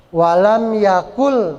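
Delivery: "yakul" slow, ending on l; merged into L. Shown as a demonstration. Teacher repeating correct idgham, "yakul" drawn out. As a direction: stretch "walam yakul", no n heard at the end. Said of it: "jadi L".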